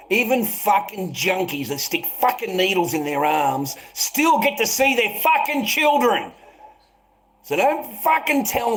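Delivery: real indignation swelling up; indignant outburst. Real, not acted. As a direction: build to a high, outraged peak. Indignant outburst about junkies.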